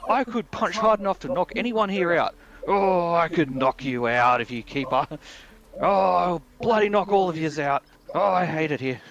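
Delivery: high, straining mock tantrum; all angry. Rehab patient boasting through his exercises, hammed-up angry strain. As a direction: rant like a frustrated patient pushing through exercises. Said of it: "like, doing it all angry".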